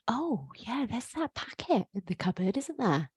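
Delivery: softly voiced sneaky thought; thinking of the packet in the cupboard, softly; soft inner thought. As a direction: murmur it as if remembering a treat. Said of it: "And then you think".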